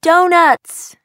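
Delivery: put-on loud, high kids' cheer; excited yell. A narrator's reading. Two kids shouting for donuts with their grandmother. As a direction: shout it with pure joy. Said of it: "yell Charlie and Lucy".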